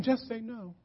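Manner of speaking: soft, understated imitation; mock mild slogan. What the coach wasn't saying to players, soft mock slogan. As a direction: say it softly, with no force at all. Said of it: "he wasn't saying".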